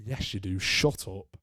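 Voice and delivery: a quiet mock argument with himself; muttered retort. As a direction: mutter it back under the breath. Arguing with himself.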